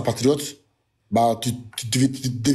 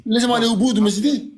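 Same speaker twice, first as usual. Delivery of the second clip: indignant demand; 6 semitones higher, much faster than usual. Real, not acted. Exige la parole, agacé.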